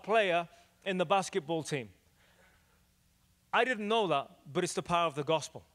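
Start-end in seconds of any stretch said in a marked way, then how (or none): none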